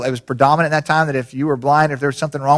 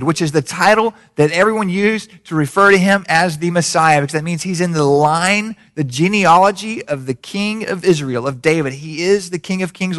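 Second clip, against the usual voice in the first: usual speaking voice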